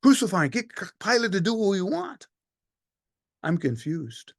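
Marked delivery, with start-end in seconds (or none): 0.0-2.3 egging on. Acted. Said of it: "kept egging the crowd".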